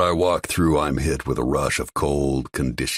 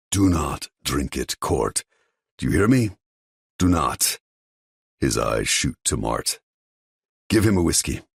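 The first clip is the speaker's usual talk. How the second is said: stern father. Acted. Father forbids his son to drink it, stern. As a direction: lay down the law.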